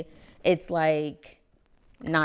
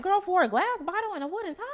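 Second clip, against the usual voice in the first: mock outrage; 14 semitones higher, 3 dB louder, faster than usual. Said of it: "And it's just like".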